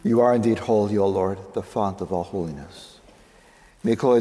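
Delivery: ordinary talk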